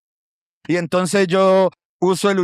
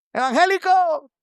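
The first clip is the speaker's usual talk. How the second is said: high-pitched mock yell, no real volume; jeering street call; 11 semitones higher than usual. People jeering at a child passing with his Bible, mock shout. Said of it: "la gente le gritaba".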